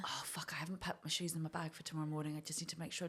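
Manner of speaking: hushed whisper, barely voiced; whispered inner thought. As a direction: whisper it like a thought in your head. Inner thought during sex.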